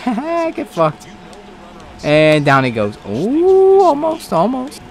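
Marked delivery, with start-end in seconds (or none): 0.0-1.1 laughing taunt. Real, not acted. Laughing taunt after mistake. 3.1-4.8 high-pitched rising ooh and almost; excited squeal. Real, not acted. Near miss on the field.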